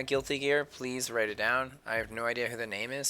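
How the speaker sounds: ordinary talk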